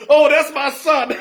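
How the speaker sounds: high, loud, drawn-out cry of a proud mother; slow, high mock holler; mock mama scream. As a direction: imitate mama hollering, on the edge of laughter. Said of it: "my mama screaming from heaven".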